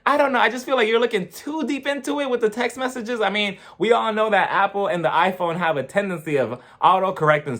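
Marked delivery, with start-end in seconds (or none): 0.0-3.2 mock reply to Lisa. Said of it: "And I'm just like".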